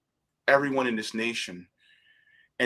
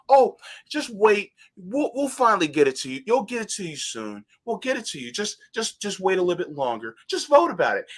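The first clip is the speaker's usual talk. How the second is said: placating adult; 7 semitones higher, faster than usual. Retold or imitated. Officials placating the hungry kids.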